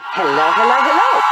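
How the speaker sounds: loud, rising sing-song hellos along with the clip. Retold or imitated. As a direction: belt the greeting along with the clip. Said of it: "play it one more time".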